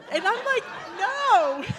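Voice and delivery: loud laughing no. Retold or imitated. Laughing loud no to sister's wild theory. Laughing denial of dad's affair.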